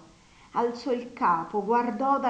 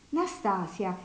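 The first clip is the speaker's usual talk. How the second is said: ordinary delivery, nothing marked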